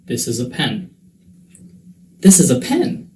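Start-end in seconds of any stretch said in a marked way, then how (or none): 2.2-3.1 PEN rising high, like a question; higher voice, ending upward on PEN; questioning intonation. Shown as a demonstration. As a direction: lift sharply on PEN. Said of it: "some examples that I thought were fun".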